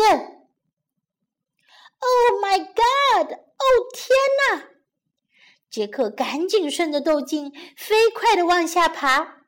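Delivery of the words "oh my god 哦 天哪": high-pitched cry of alarm. Acted. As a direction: cry out in acted fright. Jack caught by the giant. (惊慌地高声叫喊)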